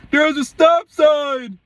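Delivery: high, drawn-out mock yell; loud, high-pitched holler, stretched out; imitated shouting. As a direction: mimic a man yelling from down the street. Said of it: "he's shouting".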